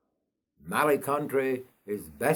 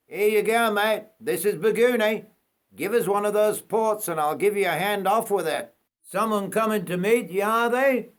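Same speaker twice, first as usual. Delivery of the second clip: higher, louder conductor voice; conductor helping off; 8 semitones higher, 3 dB louder than usual. Acted. Conductor hands off the suitcases, higher and louder. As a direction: lift the conductor's voice well up.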